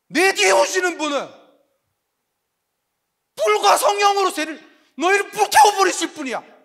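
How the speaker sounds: high, loud, building prophet's declaration; the prophet thundering his warning to the crowd; fiery proclamation. Retold or imitated. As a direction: proclaim the one who comes with fire. John announcing the coming one.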